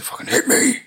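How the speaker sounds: high, breathy taunting dare; daring Joker. Retold or imitated. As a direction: goad him to hit you. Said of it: "And he's like".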